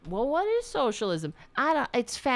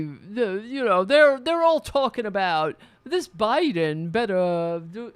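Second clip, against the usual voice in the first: loud, put-on pompous rich guy; mocking Donnie Deutsch; 6 dB louder than usual. Mimicking a TV pundit bragging about his neighborhood, loud smug voice. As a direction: ham up a smug Upper East Side blowhard. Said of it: "Donnie Deutsch going off".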